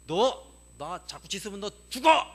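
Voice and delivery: loud, slowed retort with punched last word; mock threat. Grandmother warns the barking dog, mock threat. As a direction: imitate grandmother's threatening warning, loudly.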